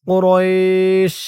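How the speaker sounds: কুরাইশ stopped on the শ, long ই drawn slowly; pausal pronunciation. Shown as a demonstration. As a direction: drag the ই in কুরাইশ, end on a stop. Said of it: "তাই আমরা পড়ব". (অক্ফ করে কুরাইশ, ধীরে টেনে)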